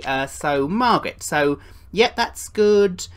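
ordinary talk, nothing marked

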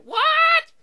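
very high, loud squeal of disbelief; mock shocked shriek. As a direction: shriek it like an outraged ghost. Acts out other ghost's shock.

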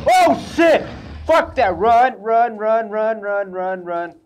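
terrified shout, fading toward the end; panicked screaming. Real, not acted. Chased by a monster in game.

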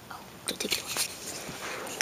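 soft unvoiced plea; whisper. Pretend customer whispers a plea to keep the cherry soda. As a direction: whisper it, begging.

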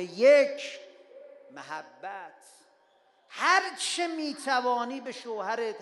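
loud, emphatic pulpit counsel; fervent preaching. Acted. Preacher counsels young couples from the pulpit, loud and fervent. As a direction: drive each point home.

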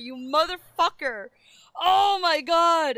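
loud fed-up shout; exasperated shout. Real, not acted. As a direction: yell at chat, fed up. Yelling at chat.

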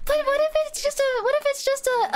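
high, fast worried pleading; anxious. Real, not acted. Player frets fast and high about a misunderstanding. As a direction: rush the words up high in worry.

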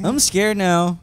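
hammed-up fear; mock scared. Echoing the mock fright.

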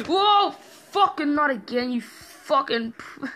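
high, loud frightened shout; startled yell. Real, not acted. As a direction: jolt and swear in fright. Another jump scare.

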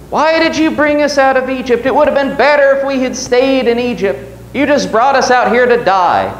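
high, whining mock complaint; Israel's people grumbling to Moses, high and whiny. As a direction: whine it like a grumbling crowd. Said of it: "they're whining".

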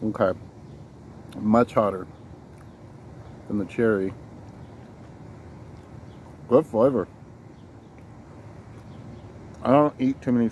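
chewing; talking while eating cookie; mouth full. Real, not acted. Seen 0: Bites cookie repeatedly; mouth visibly full and chewing while talking.

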